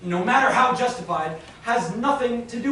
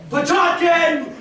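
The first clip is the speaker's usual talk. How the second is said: loud, slow bellow for the clerk; calling out; 6 semitones higher, 6 dB louder, slower than usual. Acted. Banker bellowing for his clerk, loud call. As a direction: yell for the clerk.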